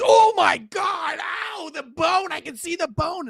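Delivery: high, slow horrified cry at the bone; imitated horrified onlooker. Mocking the guy who shrieks about the bone, high and horrified. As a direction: gasp it out like a squeamish bystander. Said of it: "Another guy comes by and goes".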